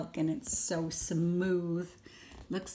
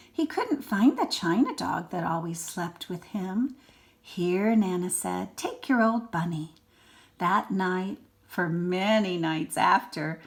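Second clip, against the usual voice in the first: put-on lively read-aloud for a small child; expressive storybook reading; story narration; 6 dB louder than usual. A narrator's reading. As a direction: read it like a bedtime picture book. Reads picture book to child.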